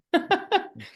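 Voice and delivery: a bright burst of laughter, high; short amused laugh; real laugh. Real, not acted. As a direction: laugh out at the joke. Laughs at shower story.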